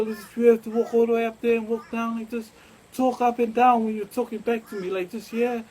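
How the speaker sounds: calling out directions, fast and loud; retold loud instructions.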